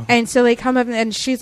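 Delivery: normal talking voice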